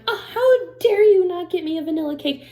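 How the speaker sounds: high, loud hammed-up outrage; mock indignant daughter. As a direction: pretend to be a scandalized, demanding birthday guest. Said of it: "she was sitting over there going".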